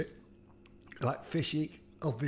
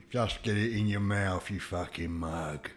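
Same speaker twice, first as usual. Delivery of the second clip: low, gruff Cockney put-on; mock Cockney, pitched well down and quieter; Cockney geezer; 9 semitones lower, 5 dB quieter than usual. Imagined Cockney viewer goading the eater, low Cockney geezer voice. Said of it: "There's a Cockney geezer watching this going".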